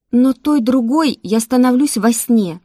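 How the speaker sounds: urgent, forceful protest; insistent. A narrator's reading. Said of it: "настаивала девушка".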